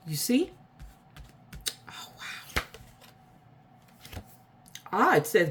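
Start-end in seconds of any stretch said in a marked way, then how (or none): 1.9-2.6 awed whisper; hushed amazement; whispered wow. Real, not acted. Reacting to card.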